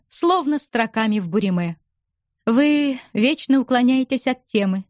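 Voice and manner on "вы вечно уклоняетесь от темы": ordinary delivery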